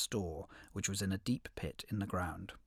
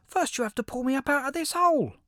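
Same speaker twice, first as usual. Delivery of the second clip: very high, loud little critter voice; high squeaky hedgehog; 7 dB louder than usual. Acted. Hedgehog calls up from pit.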